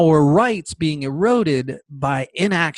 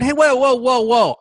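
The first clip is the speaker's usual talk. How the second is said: mock intervention; 6 semitones higher, 3 dB louder than usual. Imagined passenger steps in to object.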